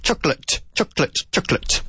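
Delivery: CHOCOLATE as quick "CHOK-lut", repeated as a drill; "CHOC-lət", stressed first syllable, short tail; two-syllable chocolate. Shown as a demonstration. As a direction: drill Chocolate with no middle vowel. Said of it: "It's choc".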